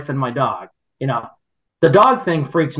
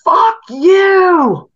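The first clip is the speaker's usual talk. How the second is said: imitating a shrieking woman, much higher and louder; retold scream; 7 dB louder than usual. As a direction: scream it like the angry neighbour did. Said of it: "loud female".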